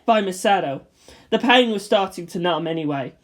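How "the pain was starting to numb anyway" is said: ordinary delivery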